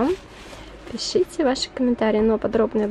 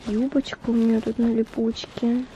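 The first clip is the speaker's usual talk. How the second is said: normal talking voice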